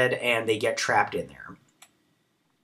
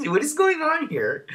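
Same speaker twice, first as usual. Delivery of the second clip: much higher, squeaky bewildered voice; 5 dB louder than usual. Retold or imitated. Viewer reacting to the screaming crew, high bewildered squeak. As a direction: squeal it out in total confusion.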